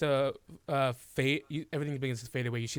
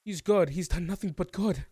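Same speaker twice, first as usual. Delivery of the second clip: raised, louder voice standing up for him; passionate defense; 6 semitones higher, 6 dB louder than usual. Acted. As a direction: insist loudly he is good.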